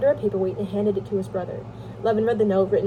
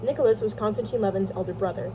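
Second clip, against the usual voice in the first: plain reading voice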